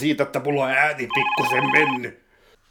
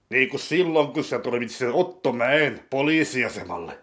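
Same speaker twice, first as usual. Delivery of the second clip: ordinary talk, nothing marked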